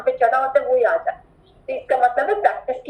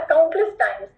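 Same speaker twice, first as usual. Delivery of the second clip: normal talking voice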